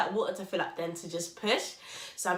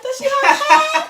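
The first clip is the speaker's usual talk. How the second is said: very high, loud and laughing; eager laughing question; 15 dB louder than usual. Retold or imitated. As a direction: ask it bursting with excitement. Asking eagerly about hair.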